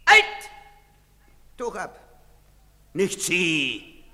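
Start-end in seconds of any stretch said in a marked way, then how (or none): 0.1-0.5 high, explosive command; shouted halt. Acted. Soldier to a hooded suspect, shouted halt. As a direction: shout to stop him dead.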